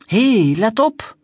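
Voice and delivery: loud, slow warning call. Acted. Firemen calling to the boy on the slippery bridge, loud and slow. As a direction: shout a warning across. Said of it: "riepen de brandweermannen".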